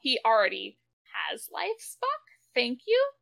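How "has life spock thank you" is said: high mocking jab at Spock; sarcastic. Real, not acted. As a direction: sneer it, pitch jumping up.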